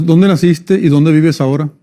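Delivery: ordinary talk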